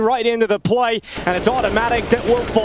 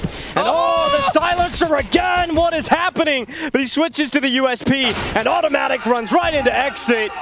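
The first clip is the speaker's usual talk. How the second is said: high, excited round call; excited play-by-play; 8 semitones higher than usual. Real, not acted. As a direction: ride the chaos with rising excitement. Caster reacts to wild round.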